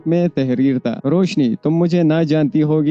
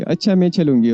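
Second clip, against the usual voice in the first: ordinary delivery, nothing marked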